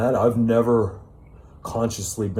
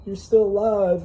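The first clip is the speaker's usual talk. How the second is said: high, loud, smug mock of the authorities; mocking doctors; 10 semitones higher, 4 dB louder than usual. Mocking doctors who claim to keep you alive, high sneering parody. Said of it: "and they say".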